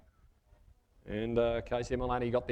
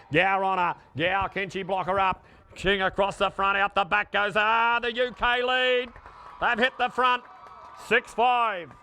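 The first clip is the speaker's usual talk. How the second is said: excited lead call; 5 semitones higher, 3 dB louder than usual. Real, not acted. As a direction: sell the moment they hit the front.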